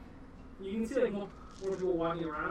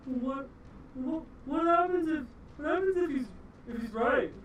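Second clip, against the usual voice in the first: higher, stammering worry; nervous worry; 9 semitones higher, 4 dB louder than usual. Acted. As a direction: fret aloud as the guard. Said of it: "just goes".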